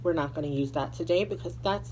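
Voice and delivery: ordinary delivery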